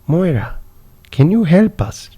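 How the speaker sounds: put-on high, pleading Indian accent; a little brother's Indian accent, pleading. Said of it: "my brother had an Indian accent".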